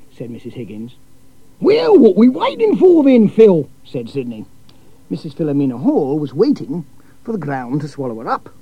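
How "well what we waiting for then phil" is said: booming, much higher, slow Australian bloke; loud cheery Aussie, drawn out; brash Aussie cousin. A narrator's reading.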